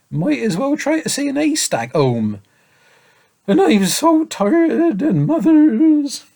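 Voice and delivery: put-on high tearful child breaking down; sobbing boy. A narrator's reading. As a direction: whimper it out, close to tears. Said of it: "he broke down again".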